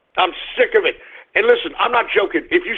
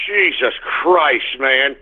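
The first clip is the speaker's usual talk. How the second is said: usual speaking voice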